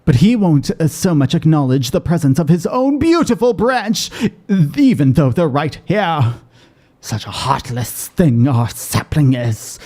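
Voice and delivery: theatrical fairy lamenting a slight; melodramatic, hard-done-by fairy voice; aggrieved fairy. Acted. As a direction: overplay the wounded fairy's lament, drawing out the final words. Voices game character's lament.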